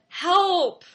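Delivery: put-on one-word plea from a little girl; child asking for help. Daughter finally asking mom for help, small plea. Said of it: "and she said".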